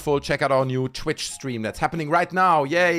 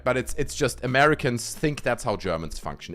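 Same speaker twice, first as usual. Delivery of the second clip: ordinary talk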